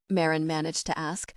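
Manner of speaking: usual speaking voice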